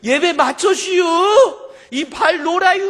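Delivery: high, loud Chungcheong holler; acted-out tearful country complaint; imitated dialect protest. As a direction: insist loudly with rising endings. Same man insists, tapping shoulder.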